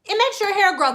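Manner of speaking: mock YouTuber in a high, quick voice. YouTubers giving viewers hair advice, mocking imitation. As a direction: chirp it mockingly, pitch way up. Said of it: "all of these YouTubers tell y'all".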